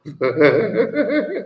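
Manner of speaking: high giggling burst; real high-pitched chuckle; amused laugh. Real, not acted. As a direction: break into a short, high laugh. Amused by slave pledge.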